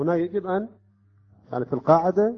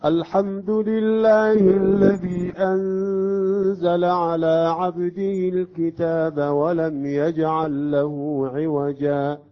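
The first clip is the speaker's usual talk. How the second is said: slow, chanted tajweed recitation, louder and higher; Quran recitation; 3 semitones higher, 5 dB louder, much slower than usual. Shown as a demonstration. Teacher recites the verse to show the stop. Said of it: "هي الآية هكذا".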